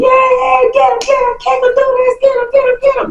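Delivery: put-on high squeaky chant of cheering girls; shrill playground cheering, get him; high mimicked yelling crowd of girls; mock girls cheering. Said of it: "all the girls out there just yelling".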